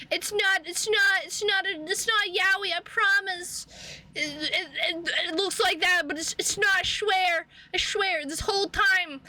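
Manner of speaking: flustered denial. Retold or imitated. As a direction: babble excuses like you've been caught.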